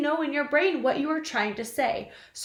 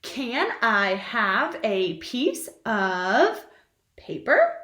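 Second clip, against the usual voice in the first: slow, clear dictation; much slower than usual. Shown as a demonstration. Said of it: "the next sentence is".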